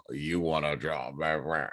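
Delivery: low, slow put-on American accent; mock American accent. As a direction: imitate Brits faking an American accent, deep and slow. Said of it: "British people to be like".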